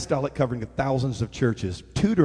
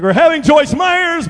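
mock hype announcement; 13 semitones higher, 11 dB louder than usual. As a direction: boom it out like a poster headline. Mock poster announcement, big voice.

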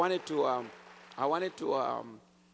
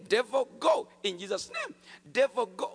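loud, high shout at the devil; shouted command; 9 semitones higher, 8 dB louder than usual. Retold or imitated. New believer rebukes the devil over the man, shouting. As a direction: bark the rebuke with full force. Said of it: "And I say it again".

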